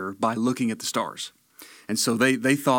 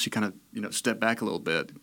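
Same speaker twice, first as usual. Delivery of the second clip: ordinary delivery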